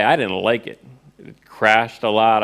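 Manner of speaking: ordinary talk, nothing marked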